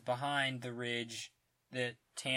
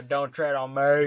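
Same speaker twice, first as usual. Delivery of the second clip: loud, hammed-up badly spoken slogan; 10 dB louder than usual. Co-host mocking the film's dialogue, loud and hammed-up. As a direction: belt it out with a distorted final ME. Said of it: "we make it sound like it's very poorly done".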